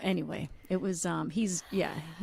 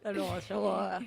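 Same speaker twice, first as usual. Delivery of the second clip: put-on Boston accent; 6 dB quieter, much slower than usual. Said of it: "pathetic Boston accent".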